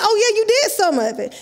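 high-pitched mocking agreement; sarcastic doctor. Doctor echoing the patient's excuse, sarcastic. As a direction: throw the excuse back with fake agreement. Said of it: "He was really sarcastic".

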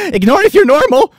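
loud, very high shriek; hammed-up high-pitched cry; mock edgy poster. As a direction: yell it like an unhinged chain post. Reads post in put-on voice.

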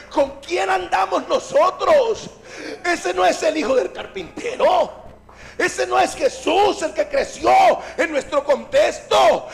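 amazed, climactic preacher's questions; astonished questioning. Acted. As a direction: demand to know who this man is. Disciples' astonishment builds.

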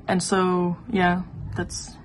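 ordinary delivery

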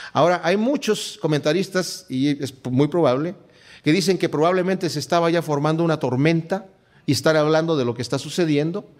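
ordinary talk, nothing marked

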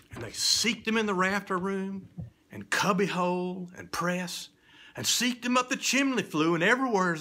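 urgent rising search; frantic search. A narrator's reading. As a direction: rattle off the hiding places.

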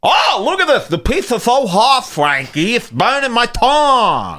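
high, whiny mock New York accent; Dave Portnoy impression. Pizza reviewer complaining to Frankie, exaggerated New York accent. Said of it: "who's getting really New Yorky".

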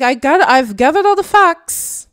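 loud, high mock English accent; hammed-up English accent, pitched high; imitated English accent. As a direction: put on an exaggerated English accent. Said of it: "imitating these, uh, th-, her accent".

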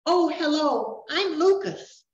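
put-on a small boy surprised to meet a fairy; young boy Lucas.

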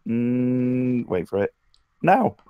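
ordinary talk, nothing marked

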